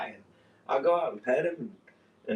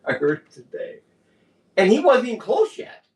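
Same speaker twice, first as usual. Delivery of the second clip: rising, louder and higher with amazement; amazed, building up to the punchline; excited; 9 semitones higher, 6 dB louder than usual. Real, not acted. Friend telling cohost about the dog, amazed and loud.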